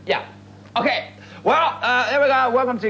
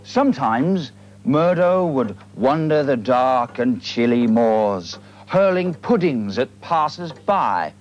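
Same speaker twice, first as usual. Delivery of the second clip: slow, low storyteller voice drawing out the eerie words; spooky tale narration; 7 semitones lower than usual. A narrator's reading. Host telling viewers an eerie Highland tale, mock-spooky narration. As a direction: spin the yarn like a fireside legend.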